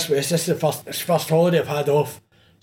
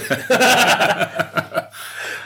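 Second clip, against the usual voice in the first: high breathy burst of laughter; cracking up; real laugh at the memory; loud laugh; 10 semitones higher, 3 dB louder than usual. Real, not acted. Laughs at own story.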